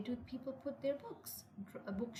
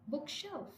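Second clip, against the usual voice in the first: "book" higher in "bookshelf"; stress on first word; 4 semitones higher than usual. Shown as a demonstration. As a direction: lift "book", let "shelf" fall. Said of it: "we stress the first word".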